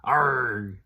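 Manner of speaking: loud pirate growl Arg. Shown as a demonstration. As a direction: bellow it like a pirate. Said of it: "Can you say arg like a pirate?".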